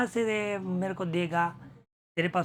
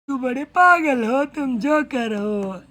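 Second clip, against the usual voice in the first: mocking taunt; 6 semitones higher, 5 dB louder, slower than usual. Flambeau taunting priest.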